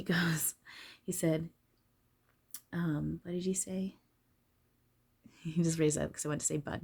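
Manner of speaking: usual speaking voice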